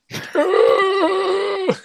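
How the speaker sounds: high, loud undead snarl; zombie roar. Retold or imitated. Joking about the reanimated Ned greeting Jon with a snarl. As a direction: let out a monster's growl. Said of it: "He's like".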